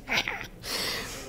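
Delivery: quick unvoiced chuckle; soft airy laugh; breathy laugh. Real, not acted. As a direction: laugh at your own joke. Laughs at her own joke.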